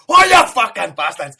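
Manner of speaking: loud, high retold scream of frustration; screaming at the telly. Fan retelling how he screamed at the match. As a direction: bellow it at the match on TV. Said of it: "I was just going".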